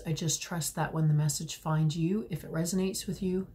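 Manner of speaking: ordinary talk, nothing marked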